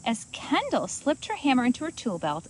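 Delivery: usual speaking voice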